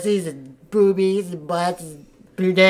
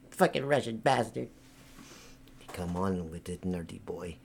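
ordinary talk

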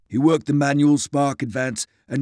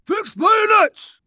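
high, slow, shouted army command; shouted order; 5 dB louder, slower than usual. Acted. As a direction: bellow the order down the line. Sergeant relays the order.

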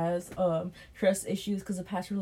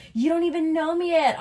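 mocking the date; 9 semitones higher, 6 dB louder than usual. Mimicking the date's protest.

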